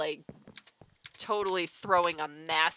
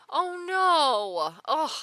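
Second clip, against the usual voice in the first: high, loud cry of dismay; dismayed sympathy; 10 semitones higher, 5 dB louder, much slower than usual. Real, not acted. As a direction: exclaim it in sympathetic shock. Reacting to viewer's sad story.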